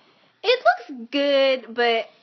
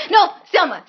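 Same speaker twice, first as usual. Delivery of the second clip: excited shout; 4 semitones higher, 10 dB louder than usual. Real, not acted. Remembering the actor's film.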